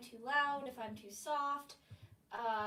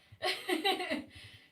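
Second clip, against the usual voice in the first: light laugh; 5 semitones higher, 7 dB louder than usual. Real, not acted. Laugh at own joke.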